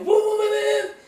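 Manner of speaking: high-pitched, loud yelp of alarm; startled, scared. Retold or imitated. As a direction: jolt awake in a panic at the wheel. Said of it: "I got so scared".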